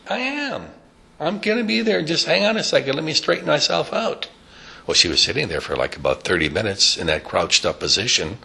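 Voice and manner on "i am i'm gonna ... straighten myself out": put-on much higher, fussy old woman; high creaky granny reply; old lady voice. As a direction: answer like a stiff-backed old lady. Old woman Stelta answers.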